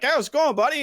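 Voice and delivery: a high, loud, cheery greeting; radio hosts greeting a guest, cheery. Retold or imitated. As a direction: greet him like an upbeat radio host. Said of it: "they're like".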